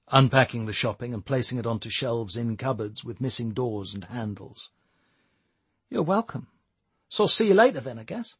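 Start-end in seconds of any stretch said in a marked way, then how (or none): 5.9-8.4 put-on shy young woman. A narrator's reading. Nicole's voice, shy.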